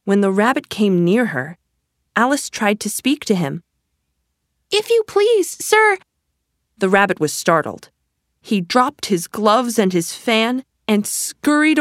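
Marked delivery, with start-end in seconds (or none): 4.7-6.0 put-on polite little girl. A narrator's reading. Alice addresses the Rabbit.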